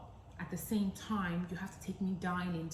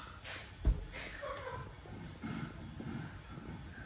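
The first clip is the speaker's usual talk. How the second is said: incredulous laugh; 5 dB quieter than usual. Retold or imitated. Laughs mid-rant.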